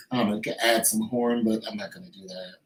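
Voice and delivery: usual speaking voice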